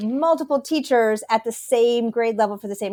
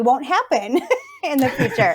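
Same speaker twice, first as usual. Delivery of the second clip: higher voice breaking into a laugh; amused, laughing; 6 semitones higher than usual. Real, not acted. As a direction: laugh midway through the punchline. Joking retelling of teacher's suggestion.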